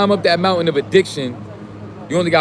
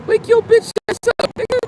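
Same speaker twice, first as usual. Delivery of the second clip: much higher, pushed wake-up yell; high yelled wake-up call. Real, not acted. Speaker yells at young viewers to wake up, high and pushed. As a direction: jolt them awake with a high yell.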